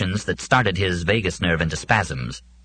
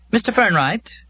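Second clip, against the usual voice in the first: competent female voice; 4 dB louder than usual. A narrator's reading. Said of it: "a practiced, competent female voice said".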